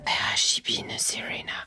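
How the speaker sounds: slow mock-mysterious whisper; slow whispered gossip teaser. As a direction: hush it like a gossip narrator. Gossip Girl parody opening.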